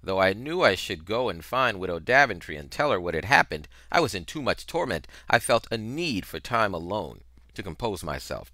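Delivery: ordinary delivery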